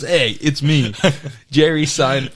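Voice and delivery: high, loud mock Brooklyn accent, laughing through it; hammed-up Brooklyn accent as a fake Jerry Seinfeld; Brooklyn accent impression. Retelling a sketch to friends, hammed-up Brooklyn accent. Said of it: "with a Brooklyn accent".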